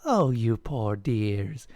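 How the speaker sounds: slow, tender cooing; pitying child. A narrator's reading. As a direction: draw it out with sympathy. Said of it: "the lead child said".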